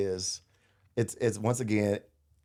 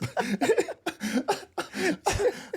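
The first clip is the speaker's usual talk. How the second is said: long, very high cackle; helpless high-pitched laughing fit; big laugh. Real, not acted. Player remembering the chin strap gift, long big laugh.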